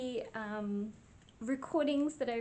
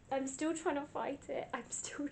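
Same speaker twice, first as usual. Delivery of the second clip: usual speaking voice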